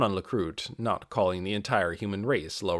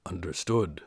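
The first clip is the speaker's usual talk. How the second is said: lower, terse, clipped acknowledgement; a lightly voiced stoic warrior; low terse knight; 6 semitones lower, 4 dB quieter than usual. Ainz's low terse reply.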